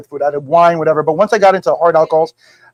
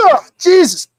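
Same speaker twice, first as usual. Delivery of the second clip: higher-pitched retold groan of disgust; disgusted reaction; 8 semitones higher than usual. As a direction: recoil from a foul drink, voice jumping up. Reaction to a foul drink, retold.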